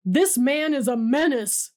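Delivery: lightly voiced sheriff snapping out the accusation; barked. A narrator's reading. Sheriff barks at the crowd about Hunter, mild bark. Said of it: "the sheriff barks".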